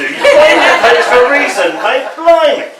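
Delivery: road rage shout. Acted. Driver in the poem yells at a motorist, furious. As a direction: yell it through the windscreen.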